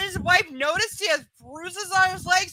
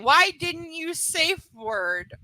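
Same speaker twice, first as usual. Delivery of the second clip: slow, incredulous "Why didn't you safe word"; deliberate word-by-word quote; mocking as written; much slower than usual. Shown as a demonstration. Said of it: "That is how it's written".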